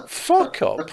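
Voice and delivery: high little heckling voice; wee voice. Retold or imitated. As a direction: pipe up with a tiny mock retort. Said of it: "it was a wee".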